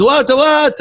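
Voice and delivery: high-pitched baffled tourist; quick repeated question, voice jumping up; puzzled French guy. Acted. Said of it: "the French guy goes".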